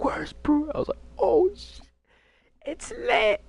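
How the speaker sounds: high comic squeal of surprise; shocked. Retold or imitated. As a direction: play your younger self startled and thrilled. His shocked reaction to dad arriving.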